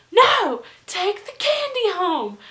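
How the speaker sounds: put-on high, loud drunk insistence; insistent mock shout. Party host forcing candy on visitors, loudly insistent. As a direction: refuse to take no, hammed up.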